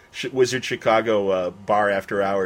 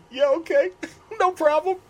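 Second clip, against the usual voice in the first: very high, giggly fanboy squeak; mock-flustered squeal; starstruck. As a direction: agree meekly in a tiny high voice, laughing. Starstruck reply to the full voice.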